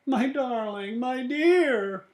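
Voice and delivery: high tender husband voice; high fussing husband. Acted. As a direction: coo over her tenderly. Husband comforts crying wife.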